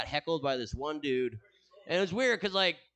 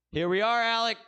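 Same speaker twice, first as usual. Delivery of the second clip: hyped greeting; 8 semitones higher, 6 dB louder than usual. Real, not acted. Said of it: "greeting friend loudly".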